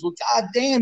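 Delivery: light mock of Lou; Lou impression. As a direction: lift it up like a mocking old coach. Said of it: "He goes".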